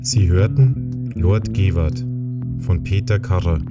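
ordinary delivery, nothing marked

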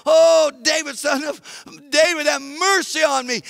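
high, loud beggar's plea; desperate crying out. Acted. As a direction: call out relentlessly for mercy. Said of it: "he's crying out".

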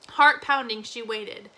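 plain reading voice

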